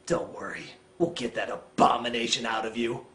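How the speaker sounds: very low, soft, measured voice; hushed, deep mock reassurance; mock-supportive Edward. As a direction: murmur it low and grave. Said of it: "looks at Bella and says".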